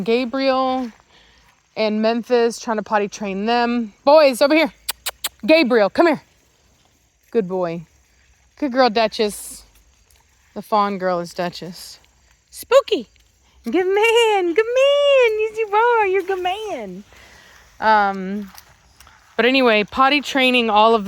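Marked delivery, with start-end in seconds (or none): 4.0-6.2 louder call across the yard; calling the dogs. Real, not acted. Owner calling her dogs back across the yard, louder. 12.5-17.0 much higher doting coo. Real, not acted. Owner doting on Spooky, much higher baby talk. Cooing at the dog.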